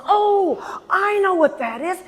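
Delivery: high, bright eager voice; aunt jumping in, sure she knows it; high excited aunt's eureka; excited recognition. Retold or imitated.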